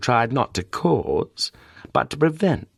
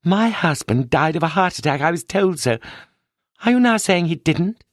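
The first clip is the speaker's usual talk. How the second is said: higher, upset widow demanding answers; Mrs Sherwood, agitated; 7 semitones higher than usual. Acted. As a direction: press him, growing alarmed and indignant. Widow confronts detective, rising alarm.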